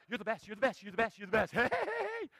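gleeful, rapid dog adoration rising high; excited dog. Acted. Forgiving dog greeting owner at the door, overjoyed. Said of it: "You come home, it's like".

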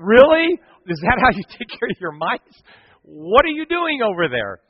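high, loud disbelieving exclamation; incredulous outburst. Retold or imitated. Retold exclamation at the son.

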